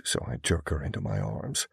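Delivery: ordinary talk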